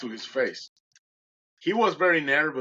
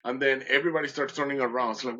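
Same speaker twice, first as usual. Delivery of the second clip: ordinary delivery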